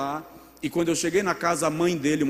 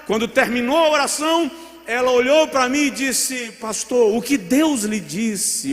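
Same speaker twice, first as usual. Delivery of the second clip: higher, louder, urgent retelling; rising excitement; 7 semitones higher, 5 dB louder than usual. Acted. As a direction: let the story climb with excitement. Story climax, voice rising.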